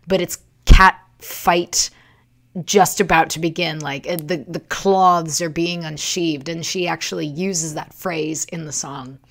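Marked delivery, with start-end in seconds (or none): none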